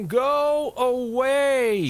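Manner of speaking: high, slow, stretched-out yell. A narrator's reading. Charlie yelling at the giant to leave. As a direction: hurl it across the valley. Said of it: "Charlie yelled".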